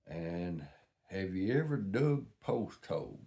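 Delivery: ordinary talk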